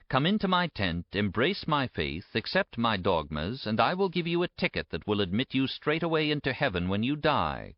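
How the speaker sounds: ordinary delivery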